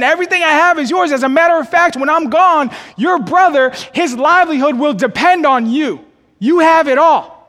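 emphatic father. Acted. Father voiced, preacher at full force.

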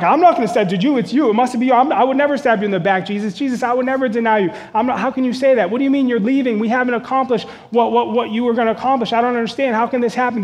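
rattled disciples talking over each other in alarm; freaking out. Retold or imitated. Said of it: "the disciples start freaking out".

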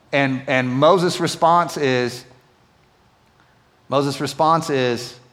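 ordinary talk, nothing marked

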